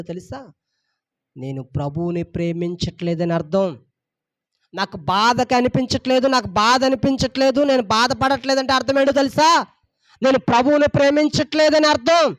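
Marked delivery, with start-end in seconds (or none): 4.7-9.7 fervent preaching. Acted. Pastor preaching to congregation. 10.2-12.4 louder and higher, hammering అర్థం; preached louder. Shown as a demonstration. Same line preached twice.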